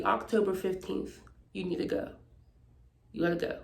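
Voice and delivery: God giving her the date to leave, hushed and low; God's voice. Retold or imitated. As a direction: hush it down like a quiet voice from above. God's instruction voiced, retold.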